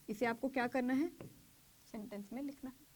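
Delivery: ordinary delivery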